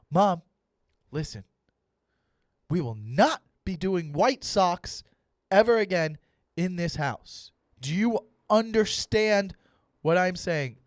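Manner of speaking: slow, emphatic mock ultimatum; stern demand. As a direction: lay down the law word by word. Acted son laying down the law.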